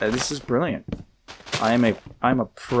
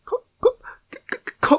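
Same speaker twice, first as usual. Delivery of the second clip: put-on much higher stuttering try at a British accent; a failed British accent start; stumbling British accent attempt. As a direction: trip over the first sound while trying to go British. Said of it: "I can't do a good British accent".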